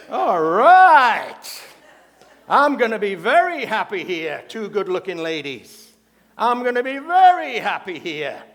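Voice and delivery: loud, drawn-out lustful parrot glee; hammed-up parrot thrilled by the company, swelling loudly; delighted parrot. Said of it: "The parrot turns and he goes".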